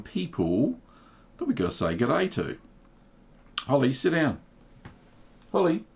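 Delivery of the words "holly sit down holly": firm call to the dog, rising high on her name; telling the dog off. Real, not acted. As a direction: call the dog to sit, pitch up on Holly. Talking to his dog.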